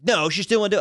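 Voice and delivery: high, loud heckler's call; imitated yell from the crowd; retold yell. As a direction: call it out like an audience member. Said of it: "someone yelled".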